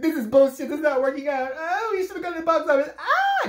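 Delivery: high, loud panicked rant; panicked freaking out. Retold or imitated. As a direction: act out a friend losing his mind and yelling. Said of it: "running around screaming".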